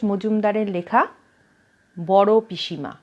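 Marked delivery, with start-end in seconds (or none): none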